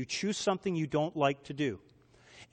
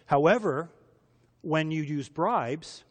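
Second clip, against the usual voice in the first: normal talking voice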